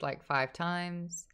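ordinary talk

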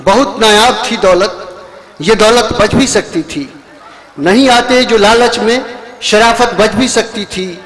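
slow, measured verse with falling close; lingering couplet delivery; poetry recitation. Acted. Poet recites ghazal couplets.

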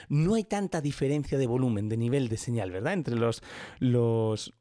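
usual speaking voice

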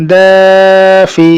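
slow, level, sustained first vowel in "দাফি"; stretched reading. Shown as a demonstration. Teacher reading through for students, "দা" drawn out. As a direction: hold "দা" long in "দাফি", then short "ফি". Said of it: "টানা পড়ে চলে যাবো".

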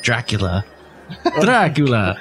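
low, mock vampire voice; Dracula. Host answering the three-bell gag, mock Dracula voice. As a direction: drop into a brief vampire impression. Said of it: "Three bells?".

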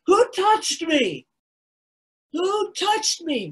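high, slow, loud searching cry; calling out. Acted. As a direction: cry out over the crowd. Jesus acted calling out again.

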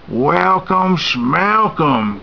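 scoffing greedy guest in a put-on voice; snorting. A narrator's reading. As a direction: snort the rhyme with contempt. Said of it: "Cutlet snorted".

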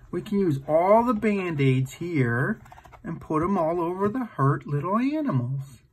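plain reading voice

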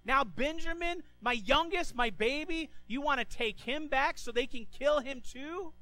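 high, loud anguished pleading; distraught father. Retold or imitated. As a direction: cry out in protest, near despair. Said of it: "He goes".

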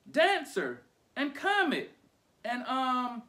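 high, loud, drawn-out name calls; child calling out his team, then searching. A narrator's reading. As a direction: call the reindeer slowly by name. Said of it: "He's calling them by name".

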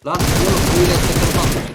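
loud teasing lecture; loud scolding. Real, not acted. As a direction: tell him off loudly. Scolding enemy's shooting.

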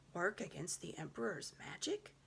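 lightly voiced stern lion; lion rebuking Susan; frowning lion. A narrator's reading. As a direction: rebuke with quiet gravity. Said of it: "something like a frown".

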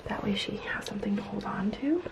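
soft whisper by a crib. Real, not acted. Whispering near sleeping baby.

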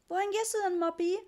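put-on very high, eager child's question; excited. A narrator's reading. Richie wanting to know where Moppy goes, excited. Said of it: "fragte Richie aufgeregt".

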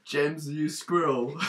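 drawn-out, subdued delivery; slow mock insult. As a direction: say it slowly, holding back. First take of repeated silly insult.

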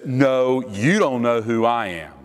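measured counter-challenge, stressing "you"; superior comeback. Acted. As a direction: turn it back on them with a weighted "you". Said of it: "the actual greater of the two".

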